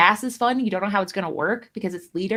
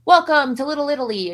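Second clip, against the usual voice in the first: mock welcome; 7 semitones higher, 5 dB louder than usual. Voicing a place's greeting, mocked.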